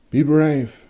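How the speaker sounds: steady the patient, voiced up; Irmgard's voice. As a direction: Irmgard urging the patient to be brave, pitched high.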